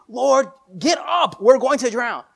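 high, loud desperate plea; panicked cry. Acted. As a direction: cry out for rescue over the storm. Disciples waking Jesus in storm.

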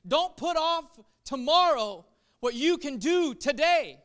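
loud preaching declaration; preached loud. Shown as a demonstration. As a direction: proclaim it to the congregation. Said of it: "that's Mark's message to us today".

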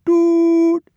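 long, loud, very high "doot"; answering whistle. A narrator's reading. Rusty answers the soaked engine, hooting. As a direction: hoot like an engine's horn. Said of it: "Rusty's answering".

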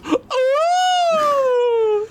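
a sudden high-pitched cry as she drops dead; hammed-up collapse yelp, very high; mock death cry. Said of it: "She goes".

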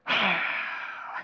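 a long, breathy annoyed sigh; put-upon sigh instead of an answer; heavy huff. Shown as a demonstration. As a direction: huff out air as your only answer. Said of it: "the huff. Anybody with a sigh?".

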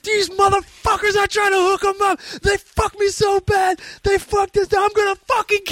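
high-pitched imitated angry rant; mock furious outburst; finally losing it. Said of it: "and he finally lost it".

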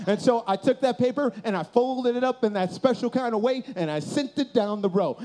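ordinary talk

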